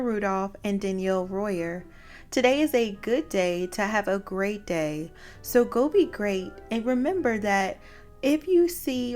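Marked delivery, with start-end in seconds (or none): none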